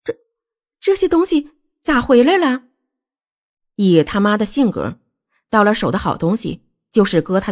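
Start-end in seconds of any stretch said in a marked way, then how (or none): 0.0-2.6 high-pitched stammer of disbelief; halting, high question. A narrator's reading. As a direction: stumble on the first word, then ask in a high voice. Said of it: "她结结巴巴地说".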